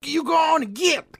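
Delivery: high-pitched, hammed-up New Yorker; mock New York accent. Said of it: "that's how they speak in New York".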